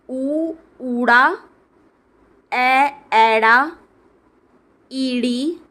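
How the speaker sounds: each letter name called out clearly with a pause; alphabet recitation. Shown as a demonstration. Teacher reads Punjabi letters aloud, a slow measured drill. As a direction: recite the letters slowly and distinctly for learners.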